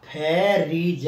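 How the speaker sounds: फेरीज broken slowly into "phe, ree, je"; very slow spelling-out of फेरीज; syllable-by-syllable demo. Shown as a demonstration. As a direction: sound out each letter of फेरीज separately. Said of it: "यानी freeze होता है".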